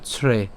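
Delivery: ছ্রে as one syllable "chhre"; careful ছ্রে with the r joined on; pronunciation demo. Shown as a demonstration. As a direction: blend chh and r into ছ্রে. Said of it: "তাহলে উচ্চারণটা কেমন হবে".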